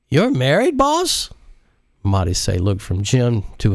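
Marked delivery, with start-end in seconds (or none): 0.1-1.3 very high rising "married, boss?"; shocked question. Acted. Jacinto stunned at his boss, rising high in disbelief. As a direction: blurt it in disbelief. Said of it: "instantly lost his buzz".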